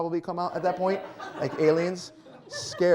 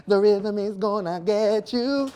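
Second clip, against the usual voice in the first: slow, higher, louder creepy sing-song threat; horror movie villain; 6 semitones higher, 7 dB louder, slower than usual. Pretend. Comic picturing a horror movie villain stalking the audience, creepy slow threat. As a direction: menace them like a slasher movie killer. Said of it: "the worst or really weird horror movie".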